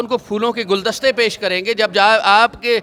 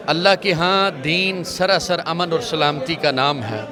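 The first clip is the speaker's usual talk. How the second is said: normal talking voice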